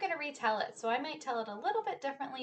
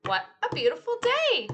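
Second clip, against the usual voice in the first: cheerful; 11 semitones higher, 7 dB louder than usual. Acted. Cheerful refrain line.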